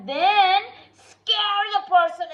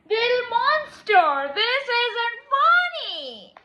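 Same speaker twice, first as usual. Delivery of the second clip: nervous plea; 5 semitones higher, 4 dB louder than usual. Acted.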